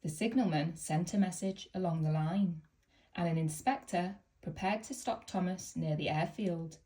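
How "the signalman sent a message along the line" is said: ordinary delivery, nothing marked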